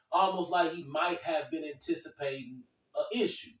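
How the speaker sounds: ordinary delivery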